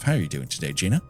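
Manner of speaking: usual speaking voice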